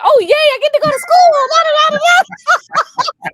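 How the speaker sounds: put-on high, loud, giddy little kid; childish glee, sing-song; excited child. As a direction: bounce it like a thrilled five-year-old. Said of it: "I was excited".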